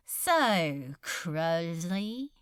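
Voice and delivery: lightly voiced, offhand demon lord; a touch of a lazy demon lord's drawl; casual demon Beelzebub. A narrator's reading. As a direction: toss it off casually, a hint of menace. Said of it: "he said, casually continuing".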